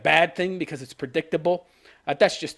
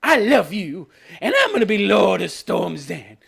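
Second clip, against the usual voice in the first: mock Gendry proposal. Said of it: "gets down on one knee and says".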